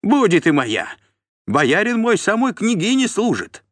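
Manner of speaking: very high, loud boasting; squealing defiance. Acted. Steward threatening the voivode with his patron, squealing defiance. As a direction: flaunt your master's rank shrilly. Тиун визгливо грозит воеводе.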